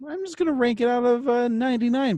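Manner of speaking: squeaky high helium voice. Pretend. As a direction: talk as if you inhaled helium. Helium balloon bit, pitch raised.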